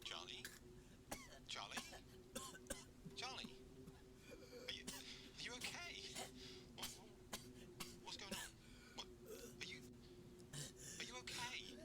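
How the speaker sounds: panicked friend on the phone, then trying to reassure; worried calling. Acted. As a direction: call out, scared for a friend.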